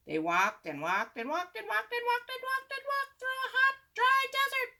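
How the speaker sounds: very high hammed-up storyteller voice; mock storyteller. Boy mocking a dull desert story, very high mock voice. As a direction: squeak through the endless walking.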